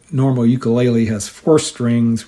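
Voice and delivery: usual speaking voice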